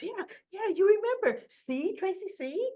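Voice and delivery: high-pitched, eager insistence; eager vindication. Acted. Ashley proving her point to Tracy, acted eager vindication. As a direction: press the friend with high excitement.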